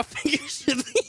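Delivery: real laughter breaks the words up; laughing. Real, not acted. Streamer scolding chat, breaking into real high laughter. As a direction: crack up mid-sentence into a high laugh.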